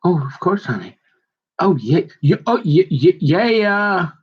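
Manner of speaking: rising, stumbling mock agreement; eagerly agreeing husband. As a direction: overdo the husband's eager yeses. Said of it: "you're like".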